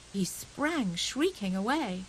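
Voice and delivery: usual speaking voice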